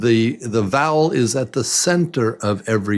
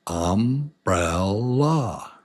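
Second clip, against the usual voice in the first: Umbrella drawn out slowly in three separate beats; syllable by syllable; much slower than usual. Shown as a demonstration. Teacher leading learners in clapping, Umbrella slow and split. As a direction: break Umbrella into um, brel, la. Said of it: "Let's say some words together".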